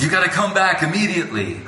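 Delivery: urgent summons. Retold or imitated. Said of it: "And he goes".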